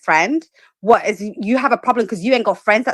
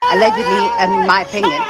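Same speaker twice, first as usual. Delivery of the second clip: sky-high sarcastic "allegedly"; mock innocent allegedly; 12 semitones higher than usual. Real, not acted. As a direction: toss "allegedly" up in a sing-song hedge. Sarcastic legal hedge.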